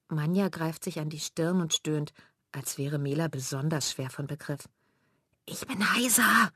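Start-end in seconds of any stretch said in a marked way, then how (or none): 5.4-6.5 hoarse, voiceless croak, a bit slow; croaking hoarsely. Acted. Elli with a cold telling the girls, hoarse croak. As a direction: croak it out with almost no voice. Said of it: "krächzt Elli".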